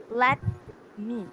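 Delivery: "Let" and "me" split by a clear pause; iambic stress demo. Shown as a demonstration. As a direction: demonstrate "Let me" as two separate syllables. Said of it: "the me is the stressed syllable".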